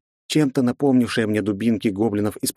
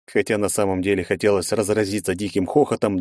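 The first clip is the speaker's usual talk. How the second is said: usual speaking voice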